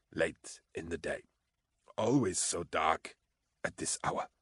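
drifting away, near death; death rattle. A narrator's reading. Dying man fading out.

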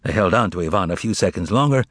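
plain reading voice

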